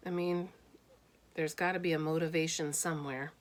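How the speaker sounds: plain reading voice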